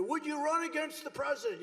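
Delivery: mild mock reporter yell; mock screaming reporter. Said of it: "these maniacs are screaming".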